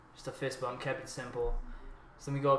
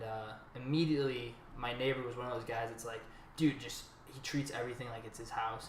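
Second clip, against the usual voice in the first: ordinary delivery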